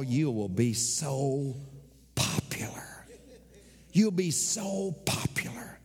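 slow, hushed seductive whisper; tempting whisper. Acted. Devil tempting Jesus on the pinnacle, whispered. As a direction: lure him in with a whisper.

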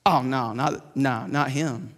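a low father's voice waving it away; dismissive father. Retold or imitated. Jesse dismisses his youngest son, in a low quiet voice.

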